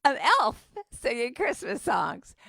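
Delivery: can't believe the word elf; shocked disbelief. Retold or imitated. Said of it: "I'm going".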